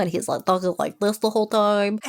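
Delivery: imitated stuffed-up head-cold voice; congested. Host mimicking a sick character from the book, congested. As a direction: talk as if your nose is blocked. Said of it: "he's just congested, and he has a cold".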